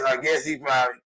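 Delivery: ordinary talk, nothing marked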